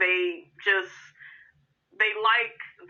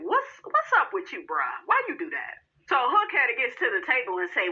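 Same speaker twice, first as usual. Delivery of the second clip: ordinary talk, nothing marked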